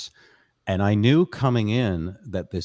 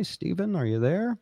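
calling out to someone; 10 semitones higher than usual. Real, not acted. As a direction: check if someone is listening, voice lifted high. Calling to a viewer online.

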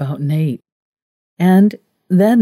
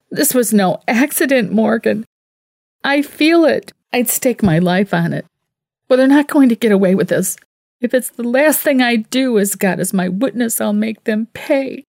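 wild anger; 6 semitones higher than usual. A narrator's reading. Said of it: "She was like a wild woman".

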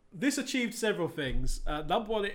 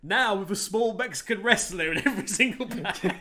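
upbeat mock ad voiceover; cereal commercial; 3 semitones higher, 4 dB louder than usual. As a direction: sell it like a TV ad. Cereal ad tagline parody.

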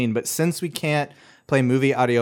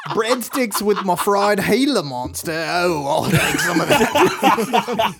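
very slow, higher, louder mock Aussie, laughing; Australian accent; 8 semitones higher, 5 dB louder, slower than usual. Cohost ordering Gila monster, laughing Australian accent. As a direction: savour the order like an outback diner. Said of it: "saying Italian dishes in an Australian accent".

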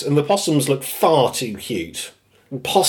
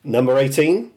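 ordinary talk

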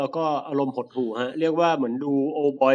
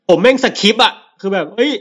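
loud, higher, an exasperated outcry; real exasperated outburst; 4 semitones higher, 10 dB louder than usual. Real, not acted. Telling friends he skipped the scene, loud and exasperated. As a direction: blurt it out like you can't bear to watch. Recalling a horrifying burning scene.

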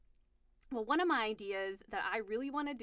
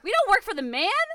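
loud, high rallying cry; hammed-up shouted protest; mock defiance; 9 semitones higher, 11 dB louder than usual. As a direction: call it out like a comic slogan.

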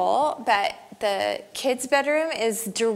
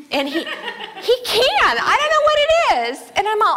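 laughing; 12 semitones higher, 6 dB louder than usual. Real, not acted. Laughing about husband's listening.

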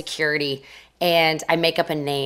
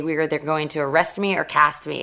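ordinary talk, nothing marked

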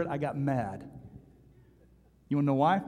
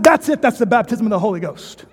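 high, loud triumph; hammed-up proclamation; triumphant evangelist; 6 semitones higher, 10 dB louder than usual. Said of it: "He said".

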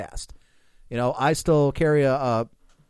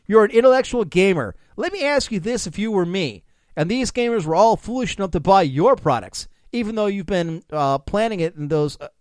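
louder, rising smug tirade; escalating comedy rant; 6 semitones higher, 5 dB louder than usual. Acted. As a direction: build the gloating rant. Fake quote builds to climax.

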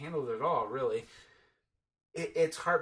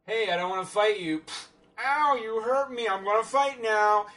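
higher, louder whiny hammed-up hero; mock hero tantrum; 7 semitones higher, 8 dB louder than usual. Mocking Superman picking a fight, hammed-up whiny shout. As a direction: play it like a spoiled kid who got hurt. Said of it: "it's just like".

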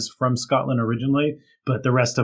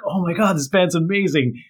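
mock excitement; 6 semitones higher, 4 dB louder than usual. Excited fans in retold story.

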